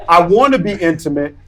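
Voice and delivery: usual speaking voice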